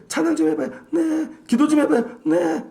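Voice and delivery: lightly mimicked women; mimicking churchwomen. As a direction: imitate ladies asking and answering. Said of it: "맨날 이렇게 얘기하잖아요".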